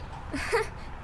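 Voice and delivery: breathy mocking snicker; unpleasant laugh. Big sister mocking the girls' story, nasty snicker. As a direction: snicker nastily under your breath. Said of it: "Der lacht ziemlich oft und nicht sehr schön".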